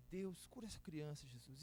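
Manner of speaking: a soft, hushed prayer for healing; quiet prayer. Retold or imitated. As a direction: pray it barely aloud over the sick child. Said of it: "vou orar bem baixinho".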